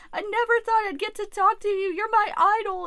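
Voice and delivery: high, teary imitation of a sobbing fan; crying fan. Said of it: "she just started fucking bawling her eyes out".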